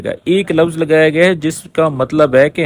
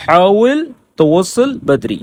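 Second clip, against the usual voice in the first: slow model sentence; 2 semitones higher, slower than usual. Shown as a demonstration. Teacher repeating the Arabic sentence for students, slowly. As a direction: say حاول توصل Badri slowly and carefully.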